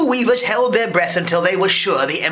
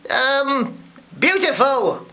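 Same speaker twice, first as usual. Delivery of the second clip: high, hesitant crowd member; slow, high, unsure praise; timid; 7 semitones higher, slower than usual. A narrator's reading. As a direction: falter timidly, searching for a compliment. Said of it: "said timidly".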